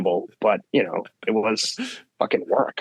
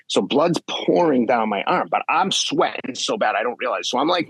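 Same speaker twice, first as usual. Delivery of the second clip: normal talking voice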